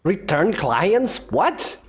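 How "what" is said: ordinary delivery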